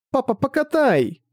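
put-on high, slow begging child; wheedling little girl; pleading little girl. Girl asks piggyback.

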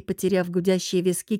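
ordinary delivery

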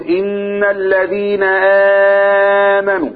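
slow recited phrase; Quran recitation. Shown as a demonstration. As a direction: chant it like tajweed recitation. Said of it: "لما تيجي تقرأ تقول".

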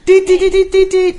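high loud beeping; alarm beeps. Acted. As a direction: beep like an alarm.

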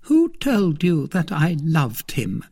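high, sharp challenge; pointed, high-pitched retort. A narrator's reading. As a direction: snap the question in a high woman's voice. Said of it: "asked Milady sharply".

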